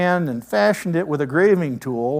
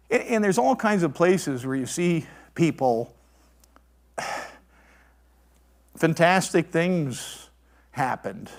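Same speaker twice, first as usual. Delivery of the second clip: ordinary delivery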